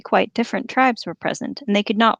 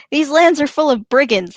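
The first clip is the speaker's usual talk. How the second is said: mock orc; 7 semitones higher, 6 dB louder than usual. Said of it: "I love that they're like".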